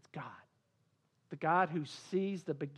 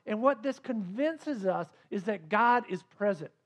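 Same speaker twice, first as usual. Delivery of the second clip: usual speaking voice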